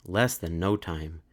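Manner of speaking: ordinary delivery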